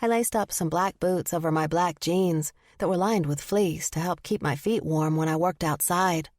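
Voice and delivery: plain reading voice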